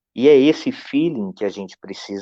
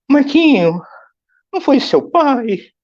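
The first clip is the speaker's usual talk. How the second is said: much higher, louder anxious aunt; alarmed aunt; 5 dB louder than usual. Retold or imitated. Aunt on the phone asking if his father died, high and alarmed. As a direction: cry out the question like a frightened aunt.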